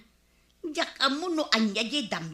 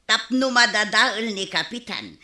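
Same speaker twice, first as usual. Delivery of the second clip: ordinary talk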